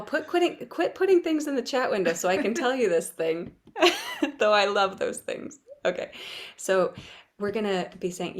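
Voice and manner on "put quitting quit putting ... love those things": laughing scold. Real, not acted. Scolding chat while laughing.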